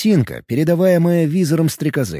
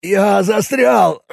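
stuck, shouting; 6 semitones higher, much slower than usual. Acted. Bob stuck, calling out.

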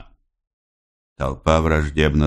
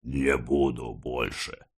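slow, low, much softer refusal; sullen; 6 semitones lower, 10 dB quieter, slower than usual. A narrator's reading. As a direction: mumble it sullenly with head bowed. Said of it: "угрюмо сказал, наклонив голову". (угрюмо, тихо и медленно)

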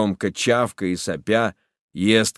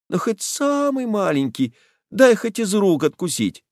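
high, whining plea; begging; 10 semitones higher than usual. Acted. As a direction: whine for just one bite. Boy begging for chocolate.

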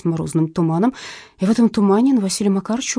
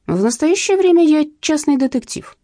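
higher, louder, full of pride; proud; 8 semitones higher, 5 dB louder than usual. A narrator's reading. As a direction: announce it proudly. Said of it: "с гордостью сообщил дядя Вася".

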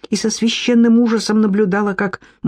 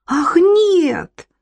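high, swooping cry; high-pitched acted outcry; alarmed exclamation; 12 semitones higher than usual. A narrator's reading. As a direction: leap up in pitch to protest. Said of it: "воскликнула опомнившаяся Софья".

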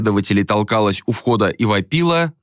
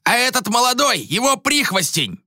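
angry accuser; 14 semitones higher than usual. A narrator's reading. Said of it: "добавил Йиим".

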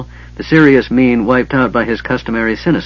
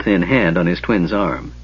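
usual speaking voice

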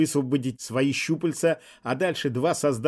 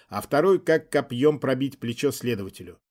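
plain reading voice

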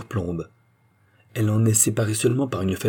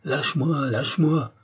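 bégaiement; 7 semitones higher than usual. A narrator's reading. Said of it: "bégaya".

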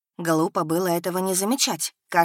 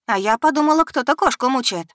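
sarcastic; 7 semitones higher, 6 dB louder than usual. Acted. Sarcastic jab at rival.

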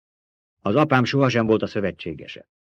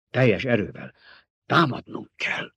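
halting, hoarse urging. A narrator's reading. Baron urges an all-out attack, hoarse. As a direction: force it out hoarsely, in pieces. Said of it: "mondta reket hangon a báró".

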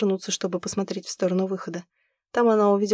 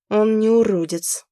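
slow and louder through gritted teeth; 2 semitones higher, 6 dB louder, slower than usual. A narrator's reading. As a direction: push it out through clenched teeth. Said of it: "процедила сквозь зубы Зима".

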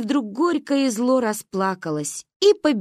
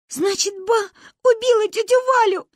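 put-on high-pitched childish wail; talking through tears; 14 semitones higher, 4 dB louder than usual. A narrator's reading. Crying girls guess Ba killed Valya, high tearful voice. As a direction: whimper it, building to a wail. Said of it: "выдвигали мы сквозь плач версии".

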